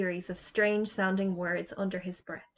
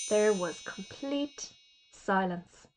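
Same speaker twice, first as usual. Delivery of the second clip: ordinary delivery, nothing marked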